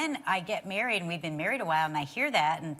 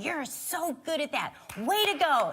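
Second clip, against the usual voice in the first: imitating cheering; 6 semitones higher than usual. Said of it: "and he's clapping".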